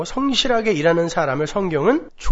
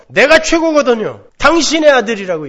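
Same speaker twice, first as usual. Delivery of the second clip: higher, louder, 당신의 hit hard and falling; smug contempt; 6 semitones higher, 6 dB louder than usual. Retold or imitated. Elder son boasting and disowning his brother, smug contempt. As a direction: sneer that he is no brother of yours. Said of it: "이야기를 해요".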